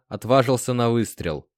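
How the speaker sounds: plain reading voice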